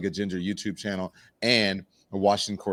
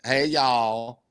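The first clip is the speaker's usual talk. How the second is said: lightly mimicked Southern greeting; a touch of mock Southern; imitated Southern accent. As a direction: echo a friend's Southern twang. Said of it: "who has the biggest Southern accent".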